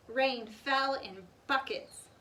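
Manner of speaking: ordinary delivery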